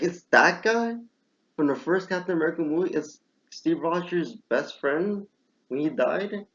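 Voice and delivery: high, incredulous questioning; retold shock. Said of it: "That shocked me".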